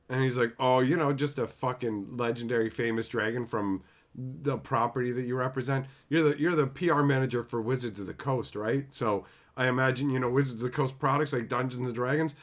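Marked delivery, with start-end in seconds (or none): none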